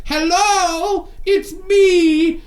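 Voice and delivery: high, far-off mock call into the dark; mock character calling out. Mocking the game's chatty character, high calling voice. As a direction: imitate a whiny game heroine calling out. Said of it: "your character will shout".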